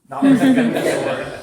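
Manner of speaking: laughs through the words, loud and high; laughing. Real, not acted. Pastor laughing at Peter's bad sword aim. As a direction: giggle it out loud, tickled by the joke.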